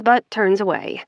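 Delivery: usual speaking voice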